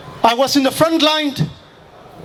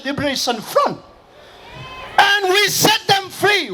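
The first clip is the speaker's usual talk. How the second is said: high, raised fiery address; rally speech; 4 semitones higher than usual. Acted. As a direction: stir up the crowd. Rally speech, recalling atrocities.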